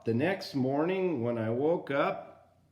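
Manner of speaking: ordinary delivery, nothing marked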